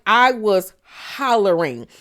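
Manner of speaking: ordinary delivery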